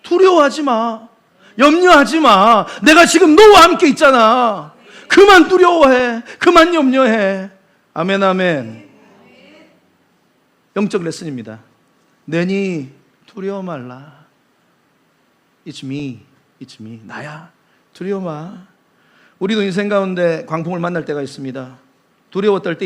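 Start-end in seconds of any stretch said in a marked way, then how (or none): 0.0-7.5 loud, slow emphatic pleading; fervent urging. Acted. Jesus earnestly urging believers to stop fearing. Jesus urges not to fear. 12.2-18.7 low, soft, slow comforting; gentle reassurance. Acted. Jesus softly telling frightened disciples not to fear.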